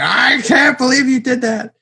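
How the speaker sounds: high-pitched, hammed-up outrage; pissed-off dad. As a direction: imitate an upset father in disbelief. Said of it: "he was really pissed".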